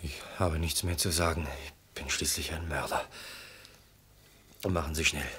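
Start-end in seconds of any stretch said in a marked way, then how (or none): 1.7-3.1 hushed, resigned whisper; voiceless whisper of a doomed man; whispered confession. Acted. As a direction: breathe it out like a final admission. Condemned man confessing before execution.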